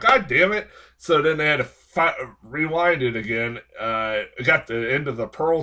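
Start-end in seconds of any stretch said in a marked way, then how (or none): 0.0-0.7 high, loud fed-up groan of a curse; a sharp high mock outcry of frustration; exasperated outburst. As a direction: let it burst out, sick of waiting. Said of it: "I'm like".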